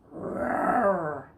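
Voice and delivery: a big growling lion roar. Acted. Storyteller roars as the lion for the children, growling.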